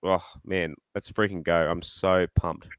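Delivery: ordinary delivery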